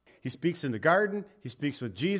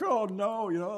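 mock dismay; 6 semitones higher than usual. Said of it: "I'm like".

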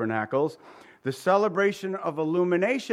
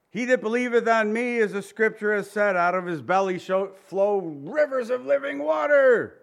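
raised, high proclamation; yelled out; 5 semitones higher, 3 dB louder than usual. Acted. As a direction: cry it out over the crowd. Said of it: "He yells it out loud".

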